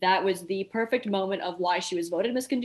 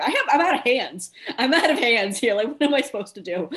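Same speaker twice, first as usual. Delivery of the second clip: flustered panic; 5 semitones higher, 6 dB louder than usual. Retold or imitated. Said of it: "And I'm going".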